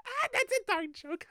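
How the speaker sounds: squealing laugh into words; high laughing. Real, not acted. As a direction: laugh through the line. Laughing at own dark joke.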